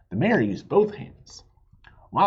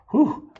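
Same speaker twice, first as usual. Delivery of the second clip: high, loud relieved exhale; relieved whew; 11 semitones higher, 6 dB louder than usual. A narrator's reading. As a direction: sigh of big relief. Relief after counting fingers.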